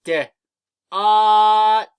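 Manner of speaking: D then ot, each sound held long and loud; D, then ot, sounded out slowly and loudly; sounding out words. Shown as a demonstration. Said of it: "Repeat after me".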